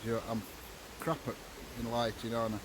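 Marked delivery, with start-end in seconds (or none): none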